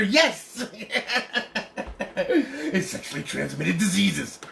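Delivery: high-pitched amused laughter through the words; laughing. Real, not acted. Laughing at STD simulator name.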